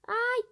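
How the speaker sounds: ai alto e agudo; grito agudo de susto. Real, not acted. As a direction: gritar de surpresa. Queda inesperada. (grito de susto)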